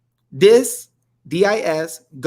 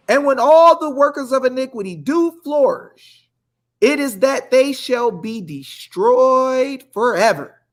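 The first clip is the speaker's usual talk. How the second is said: building preacher cadence; 5 semitones higher, slower than usual. Acted. Host preaching to viewers, rising in fervor. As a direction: rise into the verse like a sermon.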